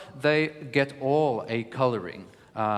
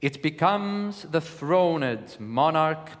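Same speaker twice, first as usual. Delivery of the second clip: higher and slow, leaning hard on the accented words; emphatic verse reading; 4 semitones higher, 3 dB louder, slower than usual. Shown as a demonstration. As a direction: read Shakespeare slowly, marking each small accent. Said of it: "So I will begin".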